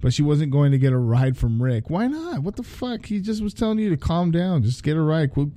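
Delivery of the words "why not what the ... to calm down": much higher, baffled why not; real disbelief at the story; shocked reader reaction; incredulous outburst. Real, not acted. Reader reacts to character's choice.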